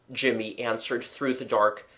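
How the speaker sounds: ordinary delivery, nothing marked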